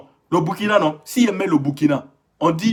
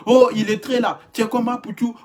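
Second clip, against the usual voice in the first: mocking commenter; 6 semitones higher than usual. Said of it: "Tu vois les voir".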